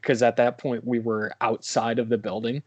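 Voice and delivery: normal talking voice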